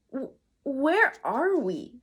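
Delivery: slow, halting stammer. A narrator's reading. Boy stammering at the strange new world. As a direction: stumble over the first word, dazed. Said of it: "stammered Jack".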